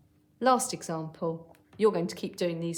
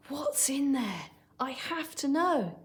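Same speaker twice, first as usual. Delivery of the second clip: high, eager and slower exclaim; excited exclaim; 7 semitones higher than usual. Shown as a demonstration. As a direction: burst out with a child's excitement. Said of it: "something you say in a slight rush 'cause you're excited".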